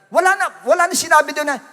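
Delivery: ordinary talk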